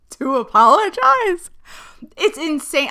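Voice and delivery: laughing at how absurd it is; laughing in disbelief. Real, not acted. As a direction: burst of incredulous laughter through the words. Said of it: "I have to laugh because it's literally insane".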